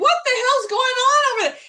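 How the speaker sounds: high, incredulous exclamation; mock disbelief. Friend recalling first hearing of the fall, incredulous. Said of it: "I was like".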